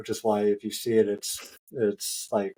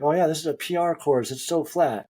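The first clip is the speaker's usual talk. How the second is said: higher, upbeat mock reassurance; breezy assurance; 5 semitones higher than usual. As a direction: imitate someone breezily selling the course. Quotes cheery person at start line.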